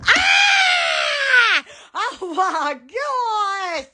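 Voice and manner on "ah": imitated high startled shriek; mock startled scream. Imagining seeing someone naked, mock shriek. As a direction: act out a shocked little scream. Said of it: "I'd be like".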